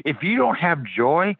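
usual speaking voice

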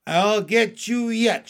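high, loud, slow vow; shouted threat. A narrator's reading. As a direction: shout it after an escaping foe. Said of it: "shouted the turtle".

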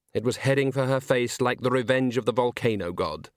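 plain reading voice

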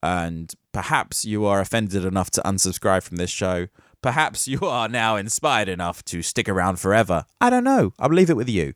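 usual speaking voice

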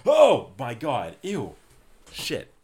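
high, panicked shriek of disgust; screaming at a spider. Real, not acted. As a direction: shriek at the spider in real fright. Spider found near her face.

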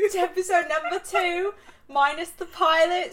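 put-on high-pitched British accent. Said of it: "We do the whole thing in a British accent".

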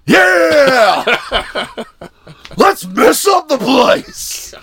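hammed-up party shout; cheering on the wall smash, laughing; mock rowdy cheer.

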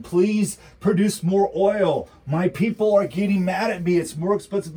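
hammed-up begging to OPEC; mock begging Biden. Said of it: "He just went to opec and begged them".

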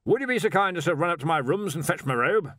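over-polite Arch-Chancellor. A narrator's reading. Arch-Chancellor sends Stibbons for his robe, over-polite. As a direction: ask with careful politeness. Wizard's strained polite request.

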